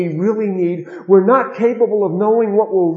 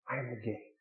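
soft echo; 5 semitones lower, 19 dB quieter than usual. Shown as a demonstration. Pastor repeats the same words.

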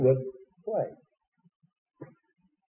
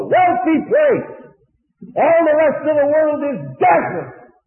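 preaching shout; 11 semitones higher, 6 dB louder than usual. Real, not acted. Preacher declaring the world barren.

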